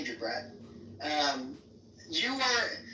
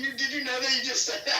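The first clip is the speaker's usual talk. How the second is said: laughing; 6 semitones higher than usual. Real, not acted. Gloating over the other's slip.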